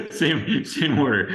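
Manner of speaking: words through a laugh; chuckling as you speak. Real, not acted. Laughing while reading the order.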